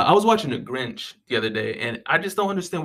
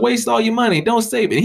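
higher, louder egging on; mock tempter; 9 semitones higher, 4 dB louder than usual. Townsfolk urging Scrooge to waste money, louder mock urging. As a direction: push him to blow his cash. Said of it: "It was like".

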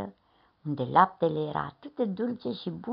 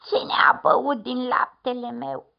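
slightly thinner, whiny middle bear; 5 semitones higher, 4 dB louder than usual. A narrator's reading. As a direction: whimper it a bit as the middle bear. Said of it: "spuse cu o voce mai subțire".

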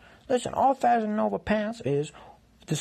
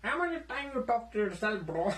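lightly voiced annoyed roommate, a bit higher; nosy roommate needling him, slightly higher pitch; intruding roommate; 8 semitones higher than usual. Roommate returns, laughing.

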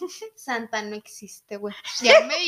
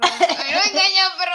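louder, higher, laughing through it; laughing loud repeat; 5 semitones higher, 9 dB louder than usual. Shown as a demonstration. Friend repeats the joke louder, laughing. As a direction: shout it again through a laugh. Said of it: "repeats it louder with a laugh".

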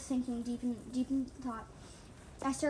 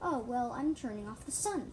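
ordinary delivery

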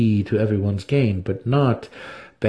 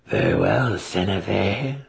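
cold ruling matron. A narrator's reading. Banray voiced throughout.

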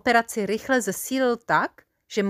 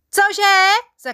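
a high, loud startled yell; full-lungs shout; 12 semitones higher, 9 dB louder than usual. A narrator's reading. Adjutant who missed the order yells back, full shout. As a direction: bellow it out because you didn't hear. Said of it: "zakřičel z plných plic".

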